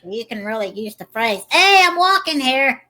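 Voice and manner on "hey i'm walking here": loud, high, drawn-out New York holler; New York accent. Acted. Cohost showing off a useful phrase, loud New York accent. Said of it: "I do a terrible accent".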